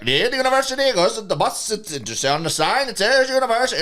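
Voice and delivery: loud, high-pitched imitation; high, hammered-out impression of someone baffling; mock incomprehensible local. Imitating someone hard to understand.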